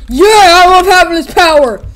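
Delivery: high, loud gleeful gloating. Retold or imitated. Villain toy gloating over his new gun. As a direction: revel in the power. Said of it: "He was like".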